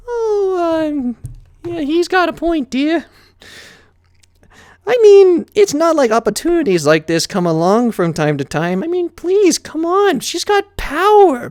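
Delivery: high, dreamy ladylike voice; Rarity siding with Spike; fancy pony. A narrator's reading. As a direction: swoon over power.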